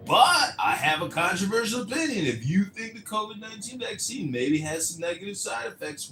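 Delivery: lightly voiced ranting vlogger; a hint of a smug lecturing host; Steve Appel. Imitating a ranting host calling viewers morons, lightly voiced. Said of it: "Steve Appel is a big liar".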